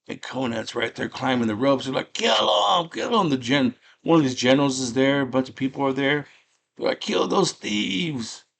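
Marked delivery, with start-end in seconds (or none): 2.1-3.3 high-pitched cries of an angry mob; raised, urgent imitation of attackers; mock mob cry. Crowd calling out in story. 7.0-8.4 high, drawn-out battle cry; slow, high imitation of attackers; mock mob cry. Crowd calling out in story.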